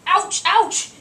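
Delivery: high, loud yelps; yelping in pain. Acted. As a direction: yelp as feet scald. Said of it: "jerks them out quickly".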